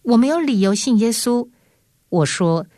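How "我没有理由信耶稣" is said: 呼叫. Retold or imitated. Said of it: "你呼叫说".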